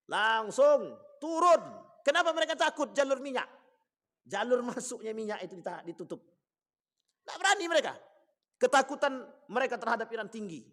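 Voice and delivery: high, loud, emphatic sermon voice; forceful preaching. Real, not acted. Preacher explains America's fear to congregation, forceful preaching.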